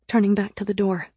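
ordinary delivery

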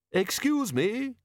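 high, slow tinny voice; courteous talking gadget, pitched right up; tinny, polite. A narrator's reading. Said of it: "in a tinny yet polite voice".

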